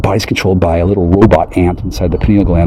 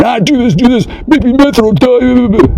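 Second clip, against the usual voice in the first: mock high-voiced threat. Mocking a gunman bossing them around, high cartoon voice. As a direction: demand it like a squeaky cartoon villain. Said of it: "pointing a gun at you and saying".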